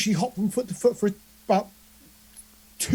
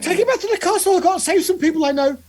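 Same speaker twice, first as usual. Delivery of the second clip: high-pitched, squeaky character outburst; really squeaky voice; 8 semitones higher, 4 dB louder than usual. Acted. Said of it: "with a really squeaky voice".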